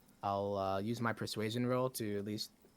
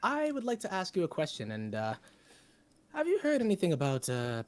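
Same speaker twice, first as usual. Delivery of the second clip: high bard voice; 8 semitones higher, 3 dB louder than usual. Acted. Bard speaking to mayor.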